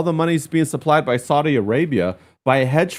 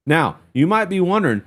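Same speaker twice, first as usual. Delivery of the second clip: usual speaking voice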